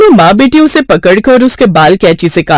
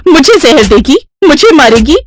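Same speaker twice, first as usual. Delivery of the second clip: higher-pitched, indignant questions; short outraged bursts, pitched up; angry accusation; 6 semitones higher than usual. Acted. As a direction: throw the accusation while striking back. Victim beats her would-be poisoners.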